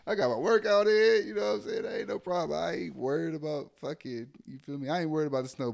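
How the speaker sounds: ordinary delivery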